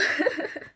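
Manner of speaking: quick airy giggle; a little unvoiced laugh; short breathy laugh. Real, not acted. Laughing at her own story, a quick airy giggle.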